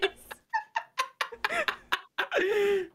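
sudden high-pitched giggle; high squeaky titter. Real, not acted. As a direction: let out a tiny squeaky laugh. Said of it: "a much more mild version of your witch laugh".

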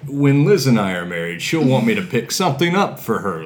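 imitating friend's boast. Mimics friend's casual statements.

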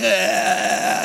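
imitated long wailing cry; mock child's crying. Preacher imitating his daughter in the car, mock crying. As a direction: wail like a little kid. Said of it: "she starts crying".

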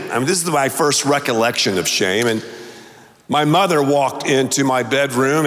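ordinary talk

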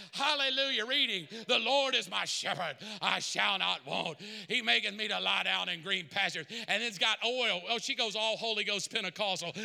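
fiery preacher pressing the church; full-force preaching. Acted. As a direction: preach it hard to the room.

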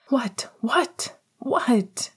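repeated high stunned whats; panicked disbelief; shocked shout. Acted. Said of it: "James shouted".

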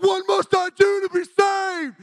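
very high, long drawn-out shout; desperate mock cry. Convicted people crying out to preacher, hammed-up shout. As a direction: wail it out loud and slow.

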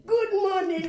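high, loud, beaming "Good morning"; loud cheery greeting. Retold or imitated. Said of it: "And they said".

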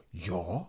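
"Yeah" low and soft, rising like a question; an odd, quiet rising "Yeah"; weird questioning YEAH. Shown as a demonstration. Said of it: "but in a weird, like".

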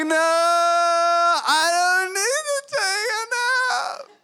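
put-on high, slow, drawn-out child's protest; whiny little kid. Dad voicing his son's whiny protest as a little kid. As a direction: play the sleepy toddler refusing bed.

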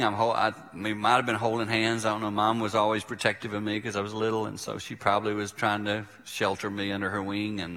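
ordinary talk, nothing marked

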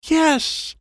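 high delighted yelp; excited yes. A narrator's reading. Boy cheering when the book is found. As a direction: burst out with joy.

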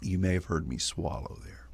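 soft whispered aside; whispering. Real, not acted. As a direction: drop to a breathy whisper. Aside after sipping coffee.

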